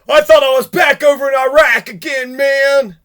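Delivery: loud, hammed-up voice of a traumatized veteran; mock shell-shocked vet. Mocking the arrested man, a loud put-on shell-shocked vet voice.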